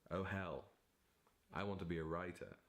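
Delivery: ordinary talk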